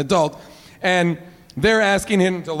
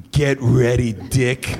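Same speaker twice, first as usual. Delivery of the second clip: gruff sky voice; 10 semitones lower, slower than usual. Retold or imitated. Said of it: "he was like".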